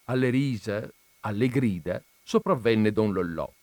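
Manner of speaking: ordinary delivery, nothing marked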